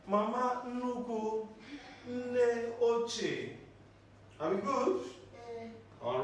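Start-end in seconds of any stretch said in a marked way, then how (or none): 0.1-3.5 Mama Nuku said slowly and spaced, Nne Oche stressed on Nne; slow correction. Shown as a demonstration. Teacher correcting a student's mispronunciation, slowly. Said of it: "Mama Nufuma".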